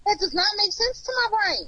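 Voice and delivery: exasperated. Real, not acted. Frustrated, repeats her objection.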